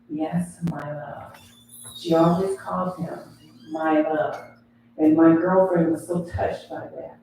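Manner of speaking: ordinary talk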